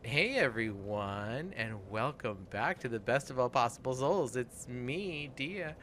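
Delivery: slow, drawn-out show opening greeting; podcast host intro. Acted. Host welcoming the listeners to the show, announcer style.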